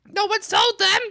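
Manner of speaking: high, loud declaration; emphatic. Real, not acted. Defending people who mispronounce, loud and high. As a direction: shout it to make the point.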